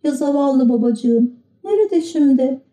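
put-on high, plaintive young puppet; boyish puppet voice. A narrator's reading. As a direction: sad little wooden boy. Pinokyo's voice, sad.

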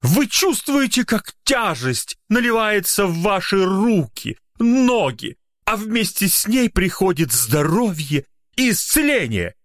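high, slow hypnotic chant; prophetic proclaiming. Acted. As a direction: intone like a trance healer. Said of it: "вещал маг". (вещающий маг)

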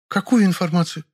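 high female voice, a touch alarmed; high-pitched wary question; slightly frightened. A narrator's reading. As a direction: ask high, mildly startled. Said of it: "Чуть даже испугалась она".

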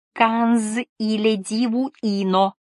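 put-on high, slow old woman's voice; astonished old lady in a strange tongue; exclaiming old woman. A narrator's reading. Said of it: "воскликнула старушка".

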